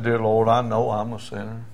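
subdued imitation of a crying man; tearful repetition. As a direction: repeat the prayer back meekly. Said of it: "He's crying".

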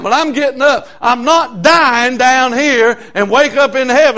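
loud, high, fired-up preaching shout; preaching at full force. Acted. Preacher shouting his defiance at the devil to the church.

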